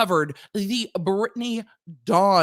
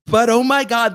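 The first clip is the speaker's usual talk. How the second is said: louder exasperated cry; burst of rage; 3 semitones higher, 8 dB louder than usual. Real, not acted. Venting about Ticketmaster fees, exasperated louder cry. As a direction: let the rage out. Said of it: "the internal rage".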